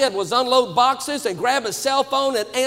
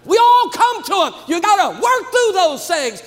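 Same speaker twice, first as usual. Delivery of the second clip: higher, louder, at full force; preaching climax; 7 semitones higher, 4 dB louder than usual. Real, not acted. Preacher urging pastors to push through, full-force preaching. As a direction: lift the congregation with it. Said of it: "preaching climax".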